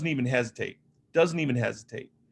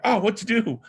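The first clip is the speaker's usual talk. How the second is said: panicked; 9 semitones higher, 4 dB louder than usual. Retold or imitated. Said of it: "I'm like".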